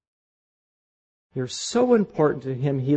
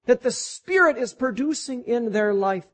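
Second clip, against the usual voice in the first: much higher, beaming proud delight; delighted God; 12 semitones higher than usual. Retold or imitated. God praising his people, beaming with delight. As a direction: show off the fruit with joy. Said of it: "He looks at you and he says".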